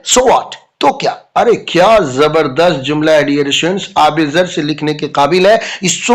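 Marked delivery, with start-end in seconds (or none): 0.0-0.5 higher, clipped challenge; dismissive teacher. Retold or imitated. Teacher challenges Raju's excuse. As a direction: toss the question back. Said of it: "madam पूछती हैं".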